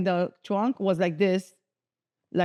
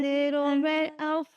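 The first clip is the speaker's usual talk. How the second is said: much higher, slow, sweet gushing; cutesy delight; 6 semitones higher, slower than usual. Retold or imitated. Picking her new car, cooing high and slow. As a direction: coo it like a cute toy. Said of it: "But I was like".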